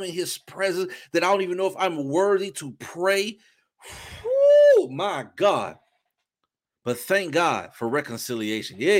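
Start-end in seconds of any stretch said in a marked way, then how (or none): 4.2-4.9 high, loud whew of release; overcome whew. Real, not acted. Preacher overcome while testifying, loud whew. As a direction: let out a big whew.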